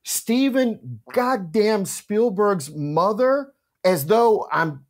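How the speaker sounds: mock blind panic, dragging the words. The teller recalls realizing who he was chatting with, in mock panic. His panicked inner thought.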